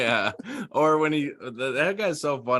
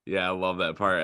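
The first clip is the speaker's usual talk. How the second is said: usual speaking voice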